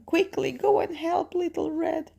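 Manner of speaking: high, urgent granny voice; grandmother. Acted. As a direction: urge them on in a high granny voice. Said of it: "grandmother is saying".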